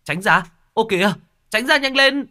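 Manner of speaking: high, loud yelled warning; passer-by shouting a warning at a runaway truck; shouted warning. Acted. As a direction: yell at them to get clear. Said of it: "hét lên".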